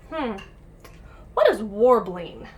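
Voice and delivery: usual speaking voice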